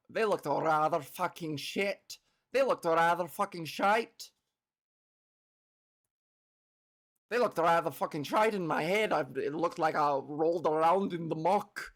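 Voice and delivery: put-on half-joking Scottish accent rolling "shite". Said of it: "It's my best Scottish accent".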